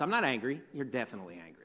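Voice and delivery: low, hushed mock exchange; muttered denial and retort. Preacher acts out a couple arguing, muttered denial and retort. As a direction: toss off both sides under your breath. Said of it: "someone in a relationship with you has told you".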